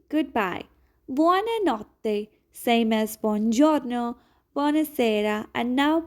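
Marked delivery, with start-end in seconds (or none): 1.1-2.3 "Buonanotte" slowly, held double t, bwoh-nah-NOT-teh; slow Italian demo. Shown as a demonstration. Teacher modelling goodnight for learners, slow careful. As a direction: stretch "Buonanotte" and hold the tt. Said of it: "Focus on the pronunciation".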